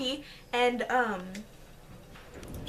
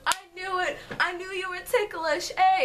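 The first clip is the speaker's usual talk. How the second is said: gloating friend; 6 semitones higher than usual. Retold or imitated. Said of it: "And he goes".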